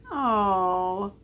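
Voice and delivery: plain reading voice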